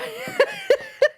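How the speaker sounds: shrieking giggle; squealing laugh; high squealing laugh. Real, not acted. Laughing again.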